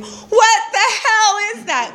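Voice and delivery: high, slow incredulous cry; hammed-up baffled exclamation; acted-out astonishment. Acts out her baffled phone call.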